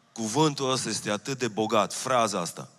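normal talking voice

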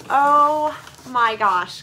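ordinary talk

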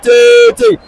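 a squealing peak of excitement; excited shriek. Real, not acted. Commentator to listeners as Ghana attack, an excited shriek.

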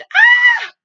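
very high, loud shriek of fright; a short terrified shriek at the giant; maid's scream. Acted. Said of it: "The maid screamed".

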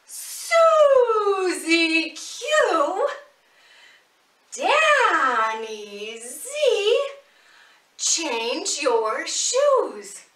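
loud, high, slow drawn-out calling; shouting call. Pretend. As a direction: call them from across the house, drawn out. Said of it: "can you shout out with me?".

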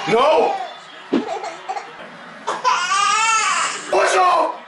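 very high, full-voiced yelling; real drawn-out outraged hollers; furious fan shouting. Real, not acted. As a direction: scream at the referees on TV.

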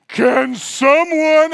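high, loud, slow whine; pleading to the house for help; drawn-out desperate plea. Retold or imitated. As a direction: stretch it out in mock despair. Desperate search for keys.

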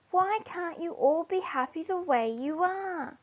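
a little warthog explaining the wishes; small warthog. Acted. Small warthog Nagiri speaks.

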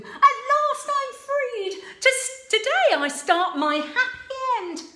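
high, loud and quick; impatient Sue. Acted. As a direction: hurry him along. Sue rejoicing.

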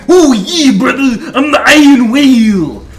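wrestler voice. Retold or imitated. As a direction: bellow it like a pro wrestler. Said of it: "sounds like a fucking wrestler's name".

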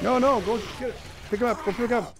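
high, urgent pleading. Real, not acted. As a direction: push her to hurry. Urging her to save dog.

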